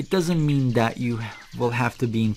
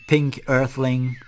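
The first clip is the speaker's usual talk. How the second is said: ordinary talk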